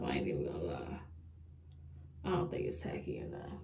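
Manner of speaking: whispered doubt to herself; low unvoiced under-breath mutter. Real, not acted.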